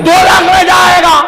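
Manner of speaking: high, ringing shout; preacher's high-pitched rallying cry; forceful call. Shown as a demonstration. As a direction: show the crowd how to say it out loud. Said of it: "ذرا زور سے بولے".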